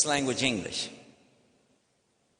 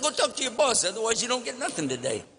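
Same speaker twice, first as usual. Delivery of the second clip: pushy ultimatum; 8 semitones higher, faster than usual. Retold or imitated. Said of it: "I said".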